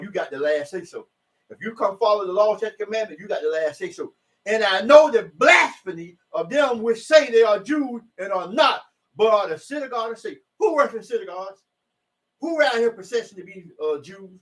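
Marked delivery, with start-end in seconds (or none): 4.5-10.2 preaching shout. Real, not acted. Preaching scripture at full force.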